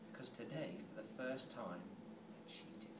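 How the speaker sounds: low, very soft admission, fading away; hushed confession. Acted. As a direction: confess it barely aloud.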